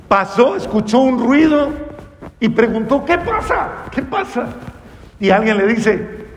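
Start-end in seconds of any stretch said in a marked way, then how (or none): 3.0-4.5 high-pitched eager asking; urgent questioning. Acted. Blind man asks the crowd about the noise, urgent. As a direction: ask urgently in a much higher voice.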